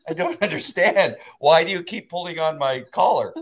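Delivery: high, pleading confused dog. Acted. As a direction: voice a baffled dog questioning its trainer. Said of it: "I'm like that confused dog".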